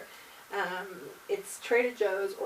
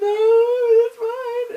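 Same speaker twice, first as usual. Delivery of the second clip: high, loud, crying it's fine; mock sobbing reassurance, much higher and louder; tearful denial; 16 dB louder, much slower than usual. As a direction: insist you're fine while sobbing. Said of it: "I was like".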